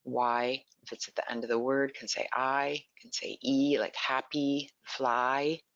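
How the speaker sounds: usual speaking voice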